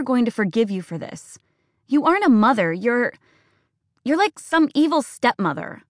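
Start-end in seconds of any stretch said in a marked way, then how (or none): 1.9-5.8 higher, shrill-edged accusing rant; furious, hurt daughter. Acted. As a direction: pour out every grievance. Daughter's tearful tirade.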